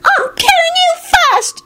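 tiny, very high cross voice. A narrator's reading. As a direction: squeak the threat crossly. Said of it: "his father replied crossly".

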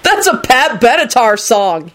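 exasperated mild shout. A narrator's reading. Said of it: "he shouted".

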